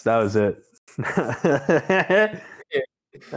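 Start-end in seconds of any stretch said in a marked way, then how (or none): 1.0-2.4 high falsetto laugh. Real, not acted. Laughs in odd voice.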